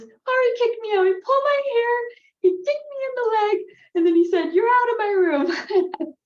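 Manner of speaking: high, loud mock wailing; imitated tearful child. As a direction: wail like a wronged little kid, hammed up. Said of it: "comes to my room crying with tears".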